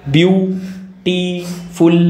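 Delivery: Beautiful very slowly, in three separate beats; beautiful stretched so each syllable stands alone. Shown as a demonstration. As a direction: sound out beautiful as BYOO, TEE, FUL. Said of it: "तुम्हारा jaw हिल रहा है".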